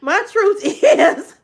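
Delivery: can barely get it out for laughing; laughing confession. Real, not acted. Joking to viewers about Leroy, laughing high.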